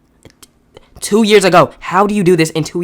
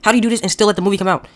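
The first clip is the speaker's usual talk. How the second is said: normal talking voice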